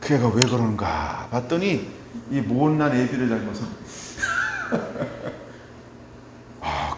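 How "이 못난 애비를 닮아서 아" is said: laughing. Real, not acted. Pastor laughing that his son takes after him. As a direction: laugh through the self-mocking line.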